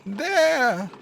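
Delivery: sharp, very high loud cry. A narrator's reading. Sparrow crying out at the carter, very high loud cry. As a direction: cry out at the deed. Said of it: "cried the sparrow".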